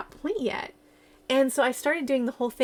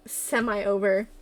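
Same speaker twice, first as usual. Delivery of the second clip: ordinary delivery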